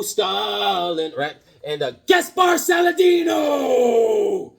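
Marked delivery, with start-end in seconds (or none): 2.0-4.5 high, loud, stretched name; drawn-out announcer shout. Pretend. Host hailing the comic's credited creator, long announcer bellow.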